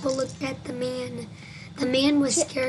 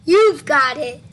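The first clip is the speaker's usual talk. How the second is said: loud sudden pounce; abrupt, punchy scare yell; jump-scare shout; 2 semitones higher, 13 dB louder than usual. Acted. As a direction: shout it to make the listener jump. Jump-scare line of the story.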